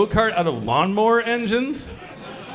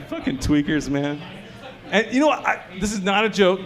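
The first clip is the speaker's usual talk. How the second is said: usual speaking voice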